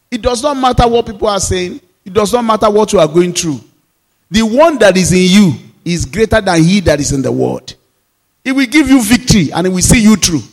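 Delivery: loud, high fervent preaching climax; fervent pulpit proclamation; preaching at full force. Acted. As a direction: rise up and preach it to the congregation.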